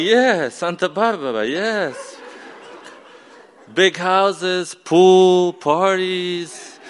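high, drawn-out dreamy excitement; excited. Retold or imitated. Boy tells his dad where he dreams of going, excited.

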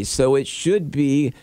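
ordinary talk, nothing marked

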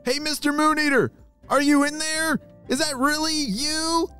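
put-on high-pitched boy calling to a creature; shouted. A narrator's reading. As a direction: call out high and eagerly across water. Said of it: "he shouted towards the river".

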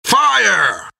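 very high yelled command. A narrator's reading. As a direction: defy the captain with the order. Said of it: "yelled Jack".

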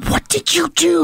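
a wife who can't believe what he did; mock alarmed wife asking her husband. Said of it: "my wife turns and she's like".

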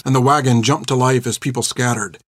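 plain reading voice

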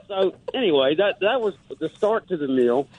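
ordinary talk, nothing marked

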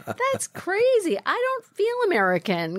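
put-on high-pitched, incredulous little-kid voice; high mock-childish disbelief; little Lisa. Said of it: "seven-year-old Lisa".

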